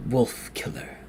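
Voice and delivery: soft whispered call, no voice. A narrator's reading. The figure calls the sleeper again, whispered. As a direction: hush it out like a summons. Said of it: "came the voice again".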